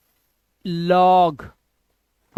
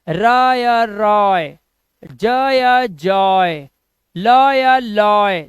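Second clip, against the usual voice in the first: slow drilled words; 5 dB louder, much slower than usual. Shown as a demonstration. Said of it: "drilling the oy sound".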